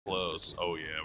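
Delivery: normal talking voice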